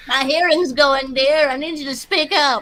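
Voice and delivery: put-on old lady asking them to speak up; granny voice. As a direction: play a hard-of-hearing granny.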